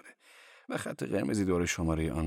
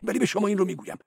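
much higher, louder, fast prattling cleric; high-voiced cleric; 10 semitones higher, 4 dB louder than usual. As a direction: play the cleric high and quick. Cleric voice on phone.

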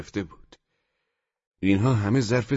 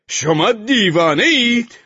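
high, loud, slow incredulous yell; outraged shout; 11 semitones higher, 7 dB louder, slower than usual. A narrator's reading. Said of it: "عصایش را بلند کرد و فریاد زد". (فریاد بلند و خشمگین)

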